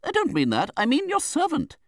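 high, loud, impatient correction; Betsy Trotwood. A narrator's reading. Aunt corrects the widow's misunderstanding, impatient. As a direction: correct her sharply, pitch climbing high.

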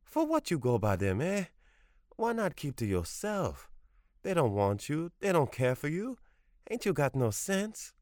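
put-on high, accented old Creole woman scolding; scolding grandmother. A narrator's reading. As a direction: scold him like a stern grandmother.

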